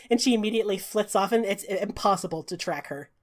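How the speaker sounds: normal talking voice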